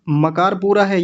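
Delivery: ordinary talk, nothing marked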